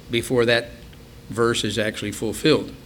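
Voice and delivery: ordinary delivery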